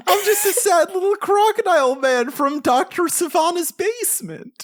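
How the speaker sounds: very high, squeaky whimper; sniveling crocodile man. Retold or imitated. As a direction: squeak like a cowering little creature. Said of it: "sniveling little baby".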